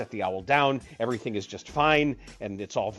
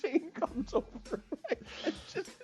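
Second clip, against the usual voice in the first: laughing; 14 semitones higher than usual. Real, not acted. Laughing at Shane joke.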